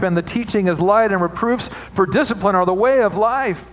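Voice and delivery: fervent sermon reading; preaching at full force. Acted. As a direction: preach the verse to the rafters.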